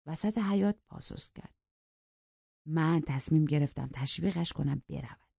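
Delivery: plain reading voice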